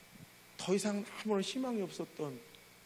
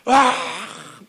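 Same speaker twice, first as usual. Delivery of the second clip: loud anguished ah!; boy's anguished outburst over his ruined homework; frustrated cry; 7 semitones higher, 20 dB louder than usual. Retold or imitated. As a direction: let out a loud wail of despair. Boy despairs over ruined clay.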